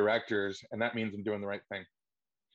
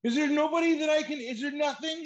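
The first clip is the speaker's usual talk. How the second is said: much higher, louder whiny plea; pleading actor; 6 dB louder than usual. Retold or imitated. Actor calling agent daily, high whiny plea. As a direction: beg like a desperate fan.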